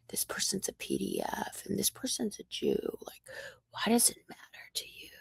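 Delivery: hushed, scornful whisper; mocking whisper at him; whispered mockery. Real, not acted. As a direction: breathe it out like a taunt. Mocking his obsession, whispered.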